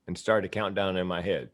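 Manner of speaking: ordinary talk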